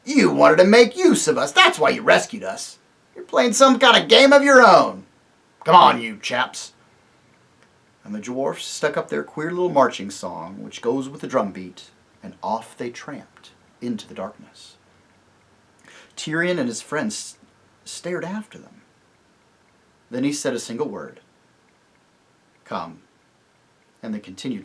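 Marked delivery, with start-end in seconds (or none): 0.0-6.7 loud, accusing dwarf; suspicious dwarf. Acted. Griffle accuses the rescuers, loud and suspicious. 22.6-23.0 lower, soft single word; quiet, weary king. Acted. Tirian calls his friends on, quiet and weary.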